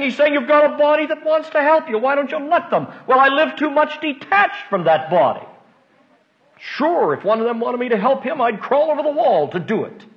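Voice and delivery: fervent pulpit delivery; impassioned preaching. Real, not acted. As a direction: press the point home at a high pitch.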